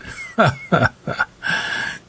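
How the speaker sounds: high, mocking villain laugh; villain's laugh. A narrator's reading. Preacher scorns the girl's accusation, villain's laugh.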